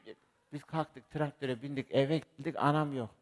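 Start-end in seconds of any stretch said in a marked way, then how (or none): none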